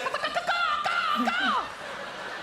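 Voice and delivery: high shrill shrieking call; bird screech again. Retold or imitated. Comedian repeating the night bird's scream for the crowd. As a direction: let out the eerie woods screech. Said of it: "He's so good at that".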